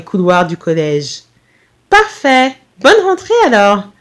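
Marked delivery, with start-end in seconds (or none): 1.9-3.9 high-pitched cheery farewell; lilting, pleased send-off; smiling. A narrator's reading. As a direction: say it through a smile. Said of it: "dans un nouveau sourire".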